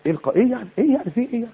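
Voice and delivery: high-pitched, soft "so what?"; dismissive. Acted. Preacher making light of a sister's marital problem, dismissive.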